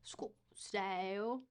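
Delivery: drawn-out, shaky attempt at Australian accent. Shown as a demonstration. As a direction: try an Australian accent, stretching the words. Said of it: "that did not sound Australian".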